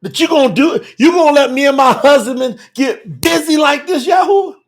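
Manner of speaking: high, incredulous retold questioning; mock astonished wife. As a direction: play her amazed appeal to God, rising at the end. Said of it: "This is really what she's saying".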